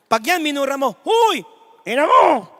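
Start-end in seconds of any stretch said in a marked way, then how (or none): 1.0-2.5 high, loud shouting curse; mock angry curse. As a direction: yell the insult. Someone cursing the angel.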